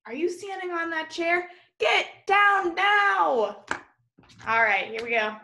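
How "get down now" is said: high, loud, slow urgent order; alarmed teacher ordering a child down; sharp command. Acted. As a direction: yank a child off the chair with your voice.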